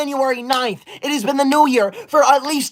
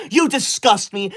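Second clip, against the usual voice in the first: ordinary talk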